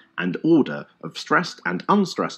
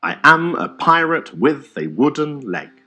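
slow, louder, every second syllable punched; iambic pentameter; 5 semitones higher, 4 dB louder than usual. Shown as a demonstration. Teacher demonstrating an iambic sentence, slow and punched. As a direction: stomp out the line like a peg-legged walk. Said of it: "a sentence that just happens to be in iambic pentameter".

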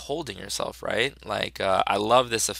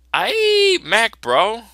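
higher and louder squeal; high excited yelp; 13 semitones higher, 11 dB louder, much slower than usual. Acted. Comic character squeals at his friend high and loud. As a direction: blurt it in a squeaky shout.

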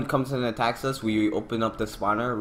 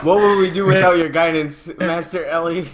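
sarcastic; 7 semitones higher, 7 dB louder than usual. Real, not acted. Teasing Ellie for stating obvious.

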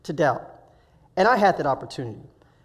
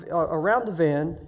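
ordinary talk, nothing marked

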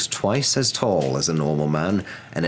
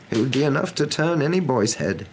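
higher-pitched, measured, earnest wizard; earnest wise wizard; 8 semitones higher than usual. A narrator's reading. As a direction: reason earnestly as an old wizard.

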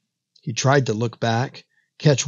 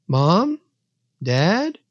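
put-on higher child's questioning call, rising at the end; hushed calling out; 6 semitones higher than usual. A narrator's reading. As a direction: call timidly for your parents, pitched up. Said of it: "called out in a hushed voice".

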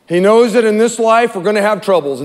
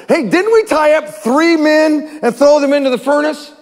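high-pitched, incredulous question; acted amazement, voice lifted high; amazed exclamation; 9 semitones higher than usual. King questions his advisors about three men, amazed exclamation. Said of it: "jumped up in amazement and exclaimed to his advisors".

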